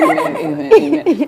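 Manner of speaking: high, laughing chorus of amens. Real, not acted. Mom seconds a blessing over her baby's life, laughing amens. Fervently agreeing with prophecy over baby.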